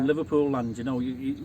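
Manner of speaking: ordinary delivery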